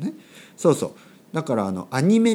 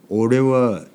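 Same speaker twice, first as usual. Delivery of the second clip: rough speech; 5 dB louder than usual. Shown as a demonstration. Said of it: "ちょっと乱暴な話し方ですね".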